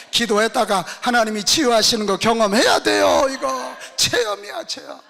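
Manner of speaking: loud, driving sermon delivery; fiery preaching. Acted. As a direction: preach it hard to the congregation. Preaching at full force. (강하게 외치는 설교)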